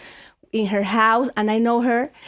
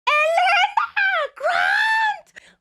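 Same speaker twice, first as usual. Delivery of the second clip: very high, drawn-out scream of the names; crazed fan yell; much slower than usual. Retold or imitated. Fan calling her idols from a boat, crazed high yell. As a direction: yell it slowly, high and frantic. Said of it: "Like crazy".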